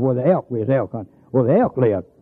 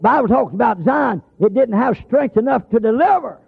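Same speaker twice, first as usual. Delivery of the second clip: high, ringing pulpit proclamation; fervent preaching; 7 semitones higher than usual. Acted. Preacher proclaiming scripture after his story, fervent preaching. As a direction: lift it up and declare it to the congregation.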